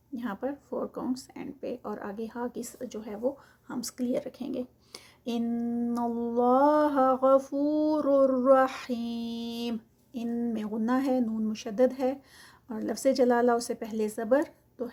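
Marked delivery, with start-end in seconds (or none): 5.2-9.8 slow, drawn-out tajweed recitation; Quranic recitation. Shown as a demonstration. Teacher reciting the verse for students, slow and melodic. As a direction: chant each word slowly, stretching the vowels.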